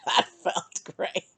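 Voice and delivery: slow words breaking into laughter; relieved laughter. Retold or imitated. Woman to ex after getting it out, laughing relief. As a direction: laugh through the words, high and drawn out.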